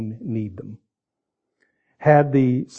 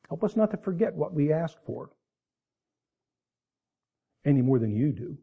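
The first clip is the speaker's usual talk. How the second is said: softer, reverent prayer voice; closing prayer; 4 dB quieter than usual. Acted. Pastor leading the church in closing prayer, soft and reverent. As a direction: pray it quietly to God.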